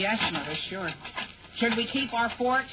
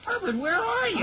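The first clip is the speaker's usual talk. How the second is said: high, startled cry into the dark; startled call; 13 semitones higher than usual. Acted. Henry startled by Herbert hiding behind the fridge, high startled call. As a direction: jump at the unexpected voice.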